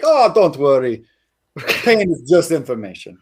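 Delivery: a light mock French accent, slowed down; playing the French friend, a hint of French accent; imitating French accent. Friend at the restaurant, lightly imitated French accent. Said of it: "he's like".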